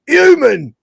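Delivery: high, loud gruff cry of Human; mock shout. Simulant spotting Lister, a high loud cry. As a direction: bark it like a monster spotting prey. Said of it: "like that".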